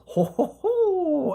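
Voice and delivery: belly-laugh it like a cartoon Santa; mock Santa laugh. Recapping Dusty alone under the mistletoe, a mock Santa ho-ho-ho.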